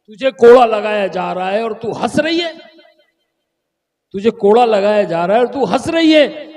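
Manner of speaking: high, stammered start into loud demand; acted royal exasperation; incredulous outrage. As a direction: demand an answer as an exasperated king. King rages at the laughing maid.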